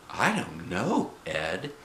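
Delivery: put-on slow, soft old woman; grandma. A narrator's reading. Grandma answers her husband, old woman. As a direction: drag it out softly, doubtful. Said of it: "said the grandma".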